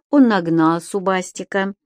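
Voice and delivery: ordinary delivery, nothing marked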